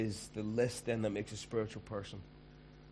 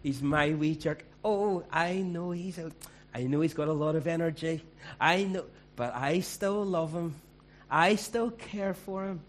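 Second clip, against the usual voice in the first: doting Scottish mum; 6 semitones higher, 6 dB louder than usual. Retold or imitated. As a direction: gush like a fond mum defending her boy. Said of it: "their mummy will go".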